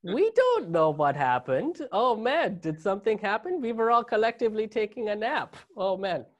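a mock-innocent German in a high voice; German accent. Germans feigning ignorance of history, hammed-up German accent. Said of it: "the best German accent you guys are gonna get out of me".

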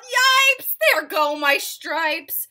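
high zebra yelp, then drawn out; loud storybook cry of dismay; yelling. A narrator's reading. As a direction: yell it in alarm, loud and stretched. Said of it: "The zebra yelled".

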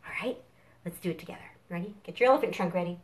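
normal talking voice

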